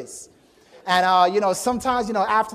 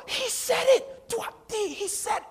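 very high-pitched, hammed-up astonishment; gasp, then squeal in mock amazement; amazed gasp. Acting out friends' amazed reaction.

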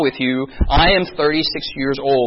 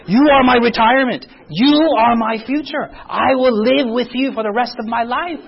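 higher, insistent declaration in a parent's voice; mock parental guilt trip; 6 semitones higher than usual. Korean parent claiming the child as retirement, hammed-up and emphatic. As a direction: hammer each claim home. Voicing parents' unspoken demands.

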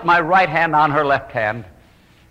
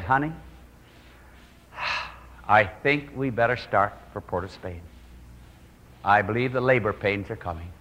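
quiet, sighing wife in labor pains; a tired wife waking her husband at night, sighing softly; soft weary wife; 6 semitones lower, 8 dB quieter than usual. Retold or imitated. Quiet worried wife waking her husband for the hospital.

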